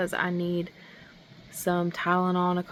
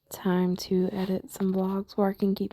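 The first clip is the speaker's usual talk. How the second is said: whispering. Real, not acted. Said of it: "whispered aside".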